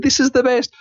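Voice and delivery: very high, excited squeal of a quote; retold elation. Speaker reliving joy at friendly Scotland, high-pitched elation. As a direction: send the pitch way up, reliving the delight. Retelling own delight in Scotland.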